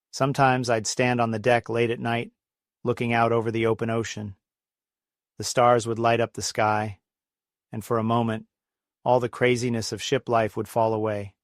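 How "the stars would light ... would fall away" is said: normal talking voice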